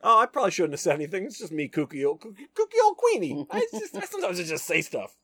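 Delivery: high, rushed, hammed-up excuses; flustered backpedaling. Queenie backpedals after letting a secret slip, high flustered babble. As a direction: play a kooky woman squirming, voice shooting up high.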